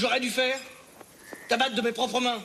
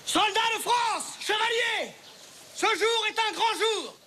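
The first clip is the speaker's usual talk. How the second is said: high, slow rallying address to troops; rally the army, slow and grand; rousing speech; 8 semitones higher, much slower than usual. Acted.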